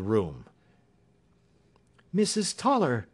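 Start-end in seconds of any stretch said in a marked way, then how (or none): 2.1-3.1 surprised cry. A narrator's reading. Miss Hunter as the woman enters, surprised cry. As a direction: exclaim the name in surprise. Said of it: "cried Miss Hunter".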